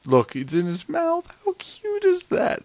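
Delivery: high, drawn-out squeal of cuteness; cooing. Real, not acted. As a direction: gush over something adorable. Gushing over cute raptor.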